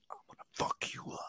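imitated menacing whisper, very soft; whispered threat. First sergeant threatens the dozing Marine, imitated menacing whisper.

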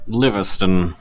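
a hint of a formal butler. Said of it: "Livingston, the butler".

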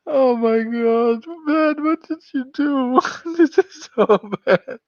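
high, loud, laughing dismay. Real, not acted. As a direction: laugh through the embarrassment. Dreading the donation dare.

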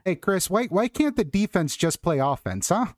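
high, hammed-up Chicago fan; Chicago accent. Shown as a demonstration. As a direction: grumble like a puzzled Chicago Bears fan. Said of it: "has the best Chicago accent".

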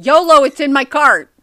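loud, high joking shout; shopping catchphrase. Acted. Co-host jokes about what they say when shopping, shouted catchphrase. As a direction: holler it like a silly battle cry. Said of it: "That's what I say when I'm shopping".

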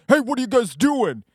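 high, loud call-out as the man by the trailer; hollering stranger. Retold or imitated. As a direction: holler it like a nosy local challenging intruders. Acted quote of suspicious trailer man.

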